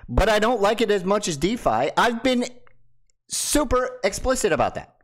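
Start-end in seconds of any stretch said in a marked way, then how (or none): none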